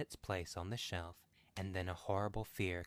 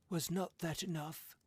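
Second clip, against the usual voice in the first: angry demand; 10 semitones higher, 3 dB louder than usual. A narrator's reading. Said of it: "he demanded angrily".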